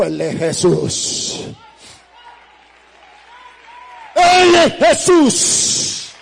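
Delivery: drawn-out, full-force high shout; retold desperate shout. Man in hell spotting Jesus, shouting desperately. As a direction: cry it out slowly at the top of your voice. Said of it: "Dice que le gritaba".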